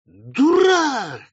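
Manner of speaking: very high, loud, stretched-out insult; drawn-out boozy jeer; drunk. A narrator's reading. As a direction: crow it like a drunk agreeing. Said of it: "подтверждал другой".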